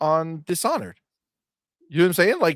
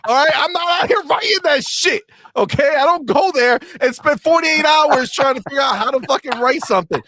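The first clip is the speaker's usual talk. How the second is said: higher, louder indignant denial; fired-up rant denying he writes essays; loud defensive protest; 9 semitones higher, 5 dB louder than usual. Real, not acted. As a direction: insist you'd never bother, worked up. Defending himself, animated.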